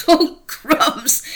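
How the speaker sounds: loud laughing outburst; laughing exclaim. Acted. Elle hearing she must play a French manageress, laughing loudly. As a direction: burst out laughing at the idea.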